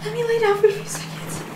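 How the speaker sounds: high, soft, tearful plea; overwhelmed whimper. Acted. Mother needing a few seconds, soft high whimper. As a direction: beg weakly for a rest.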